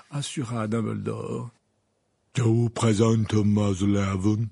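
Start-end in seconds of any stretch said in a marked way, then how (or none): 2.4-4.5 grand lady voice. Acted. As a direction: present them grandly. Madame Maxime voiced.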